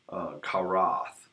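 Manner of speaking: karath said slowly as KAH-rahth; Hebrew word. Shown as a demonstration. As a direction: karath drawn out, stress on first syllable. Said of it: "no sense in asking for a correct pronunciation".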